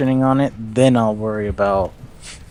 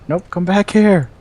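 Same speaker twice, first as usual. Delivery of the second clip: higher-pitched retold call-back; mock calling back; 9 semitones higher, 4 dB louder than usual. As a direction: playfully order a runaway drone back. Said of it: "I'm like".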